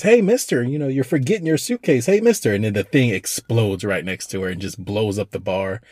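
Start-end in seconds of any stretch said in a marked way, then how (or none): 0.0-2.5 put-on high, light child calling out; little girl voice. Girl calls the man back for his suitcase, high child voice. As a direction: pitch way up like a ten-year-old girl. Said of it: "this ten-year-old girl says".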